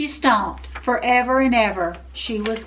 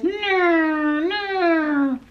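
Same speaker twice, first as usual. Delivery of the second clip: high droning airplane imitation; airplane noises; 5 semitones higher than usual. A narrator's reading. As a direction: drone like a little plane in flight. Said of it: "made airplane noises".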